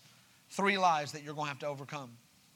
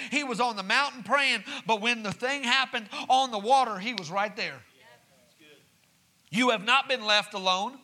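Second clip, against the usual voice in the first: higher, louder, driving preacher cadence; preaching build; 5 semitones higher, 4 dB louder than usual. Acted. Pastor assuring the church God is not distant, preaching hard. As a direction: lift it up and preach it home.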